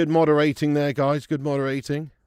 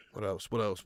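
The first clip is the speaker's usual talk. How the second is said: ordinary delivery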